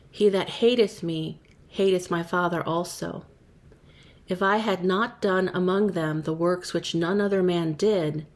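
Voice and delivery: ordinary delivery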